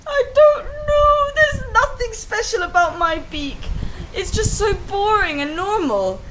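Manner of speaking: high, crying complaint; tearful little bird voice; whiny bird. Acted. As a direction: wail it out like a sulky little bird. Little bird complaining about beak.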